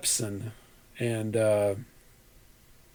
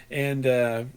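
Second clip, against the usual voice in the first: normal talking voice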